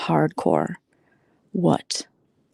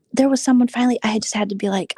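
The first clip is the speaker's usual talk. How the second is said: ordinary talk, nothing marked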